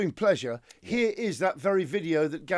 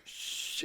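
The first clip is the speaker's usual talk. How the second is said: sharp whispered shh; shushing hiss; 15 dB quieter than usual. Pretend. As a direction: hush the men. Hushing in acted raid.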